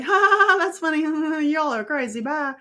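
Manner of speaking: high, loud put-on laugh brushing them off; mock cheery ha-ha-ha then a breezy goodbye; fake laugh. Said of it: "I laughed".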